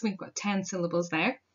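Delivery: ordinary delivery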